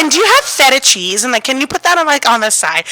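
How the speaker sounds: picky customer. Retold or imitated. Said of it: "And she was like".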